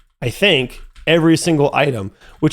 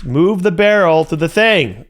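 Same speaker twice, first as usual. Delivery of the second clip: ordinary delivery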